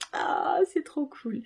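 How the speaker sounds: slow, high gush of delight. Real, not acted. Booktuber unboxing shows viewers the playlist, gushing slow and high. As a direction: melt over the discovery. Discovers the Spotify playlist QR code.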